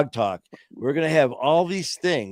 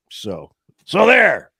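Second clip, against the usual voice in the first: slow, louder final word; emphatic finish; 5 semitones higher, 7 dB louder, slower than usual. Real, not acted. Host closing his rant on addiction, slow and emphatic. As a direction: land it like a mic drop.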